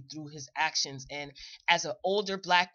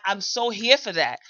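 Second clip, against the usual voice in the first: ordinary talk, nothing marked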